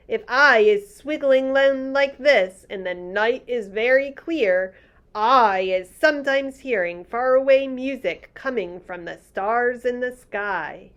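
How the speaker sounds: gentle giant marvelling; lightly voiced friendly giant. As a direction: read the giant's lines a touch bigger. BFG giant voice.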